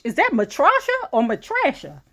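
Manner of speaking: "Matrasha" first with an AH, then with the A of trash; two pronunciations compared. Real, not acted. Reader unsure of a name, "Matrasha" said ma-TRAH-sha, then ma-TRASH-a.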